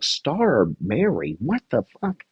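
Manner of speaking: slow, drawn-out baffled question, jumping high at the end; baffled and swooping upward; acted incredulity. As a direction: replay your disbelief, stretching each word. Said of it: "And I was like".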